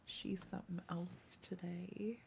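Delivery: whispered aside. Real, not acted. Telling the stream about the cat, whispering. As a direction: drop to a confiding whisper.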